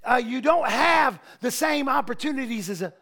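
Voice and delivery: loud, building preacher's cadence; preaching. Real, not acted. Pastor preaching at full force to the church. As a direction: preach it to the congregation. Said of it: "I'm preaching right now".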